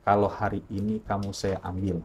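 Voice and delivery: low-key, hushed voice from within; soft, measured warning; mysterious voice. Retold or imitated. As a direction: quote an ominous inner voice, softly. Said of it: "muncullah kalimat itu lagi".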